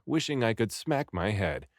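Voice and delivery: ordinary delivery, nothing marked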